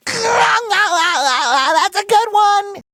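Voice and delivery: high cartoon cackle into the words; high, tickled laughter; big goofy laugh. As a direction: laugh long, then speak through it. Laughs at fortune cookie joke.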